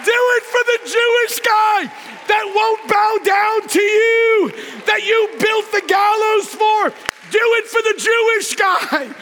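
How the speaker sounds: high, loud mock-royal shout; king shouting. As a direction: yell it like an exasperated king. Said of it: "He says".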